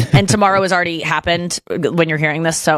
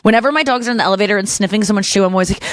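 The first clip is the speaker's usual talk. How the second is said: ordinary delivery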